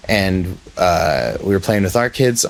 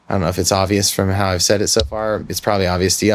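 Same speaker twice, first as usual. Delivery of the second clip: ordinary delivery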